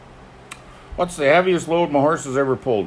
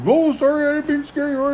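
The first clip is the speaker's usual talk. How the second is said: much higher, apologetic pilot voice; 4 dB louder than usual. Retold or imitated. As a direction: sheepishly say sorry as the pilot.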